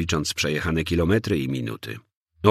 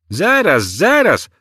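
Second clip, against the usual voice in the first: high, loud call to halt; officer's urgent holler; 12 semitones higher, 8 dB louder, slower than usual. A narrator's reading. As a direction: shout it sharply to stop someone leaving. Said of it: "zawołał posterunkowy".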